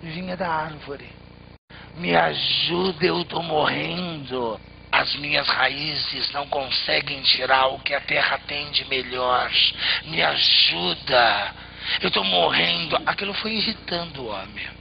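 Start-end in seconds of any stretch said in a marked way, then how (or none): 2.0-13.0 low, louder dying tree plea; a withered tree begging, low and heavy; pleading tree. A narrator's reading. Tree begs the man for help, low and louder.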